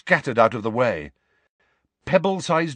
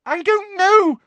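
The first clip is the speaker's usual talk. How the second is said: very high, loud squeal; terrified squeal; 7 dB louder than usual. A narrator's reading. Girl answering a roaring giant, terrified squeal. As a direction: squeal it out in panic. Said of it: "squealed Hermione, terrified".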